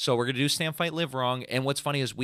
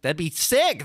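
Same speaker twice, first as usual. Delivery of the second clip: ordinary talk, nothing marked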